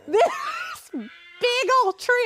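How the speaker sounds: high, loud and slow, stretched out; incredulous telling. Real, not acted. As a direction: drag it out in disbelief. Laughing retelling of the mishap.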